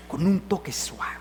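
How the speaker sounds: soft whisper of "con un toque suave"; whispered gently. Shown as a demonstration. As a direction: breathe it out, barely voiced, as a soft touch. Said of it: "pero con un toque suave".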